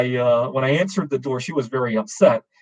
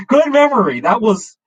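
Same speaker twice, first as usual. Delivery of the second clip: high, loud words through laughter; amused, pitch leaping up; laughing; 9 semitones higher, 6 dB louder than usual. Real, not acted. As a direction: burst out chuckling at a fond memory. Laughing over his own story.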